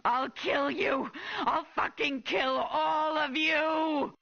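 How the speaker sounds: sobbing, screaming threats; crying furious shout. Acted. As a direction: scream the threat through tears. Mother threatens officers, crying.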